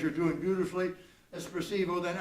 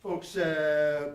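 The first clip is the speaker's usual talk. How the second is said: usual speaking voice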